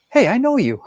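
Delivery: a sudden loud, high blurt. Retold or imitated. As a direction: shout out what should stay in your head. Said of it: "really it was supposed to be your inside voice".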